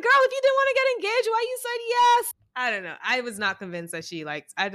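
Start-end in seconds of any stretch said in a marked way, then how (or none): 0.0-2.3 much higher, fast and loud; sassy disbelief. Retold or imitated. Host confronting Shayna about saying yes, in high shrill disbelief. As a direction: rattle it off in high pitched outrage. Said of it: "I'm like".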